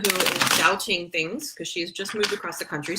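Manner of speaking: ordinary delivery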